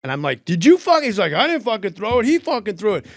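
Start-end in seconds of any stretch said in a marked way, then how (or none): 0.5-1.0 high, loud furious shout; angry yell. Retold or imitated. Comic yelling at a standing audience member. Onstage fight with heckler. 1.3-3.1 higher, defensive protest; defensive denial. Retold or imitated. Heckler protesting to the comic, defensive.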